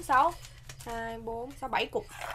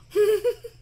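tiếng cười to vút cao; phá lên cười; cười bật lên; 9 semitones higher, 9 dB louder than usual. Real, not acted. Cười sau khi nếm. (bật cười to)

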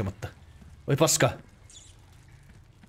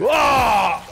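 sudden high loud scream of fright; startled scream; 12 semitones higher, 9 dB louder than usual. Real, not acted. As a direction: yelp out in real shock. Ambushed in the game. (säikähtänyt kiljaisu)

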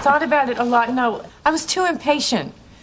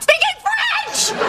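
exasperated shout; slower than usual. Real, not acted. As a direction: burst out in disbelief. Exasperated outburst.

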